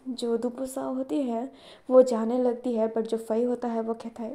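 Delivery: usual speaking voice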